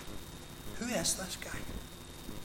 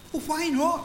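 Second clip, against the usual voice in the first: much higher, louder challenge; indignant little brother; 13 semitones higher, 3 dB louder than usual. Acted. David challenging the frightened soldiers, indignant. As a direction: demand why nobody will fight.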